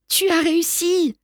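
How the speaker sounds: exclamation joyeuse plus aiguë; s'écrier de fierté pour son frère; s'écrie. A narrator's reading. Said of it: "s'écrie mon frère". (le frère s'écrie de joie en voyant le saut réussi)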